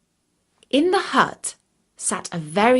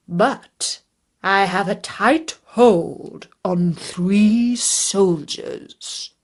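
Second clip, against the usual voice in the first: slow, louder dragon voice; dragon, gloating; 4 dB louder, much slower than usual. A narrator's reading. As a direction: relish the hold he has.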